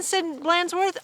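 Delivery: plain reading voice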